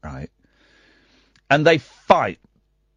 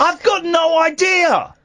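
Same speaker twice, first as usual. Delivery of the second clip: shouting; 11 semitones higher than usual. Real, not acted. Host shouting reply.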